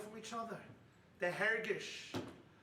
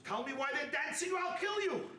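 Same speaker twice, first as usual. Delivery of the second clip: raised, high-pitched retold threat; threatening demand; 10 semitones higher, 6 dB louder than usual. Prison warden to the Gentile prisoner, threatening demand. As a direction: demand it forcefully, voice jumping up.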